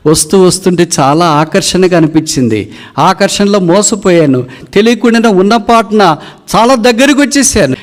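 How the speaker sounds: wailing lament, high and loud. Acted. The wounded eagle wails to the hunter, high and loud.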